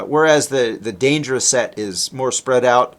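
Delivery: usual speaking voice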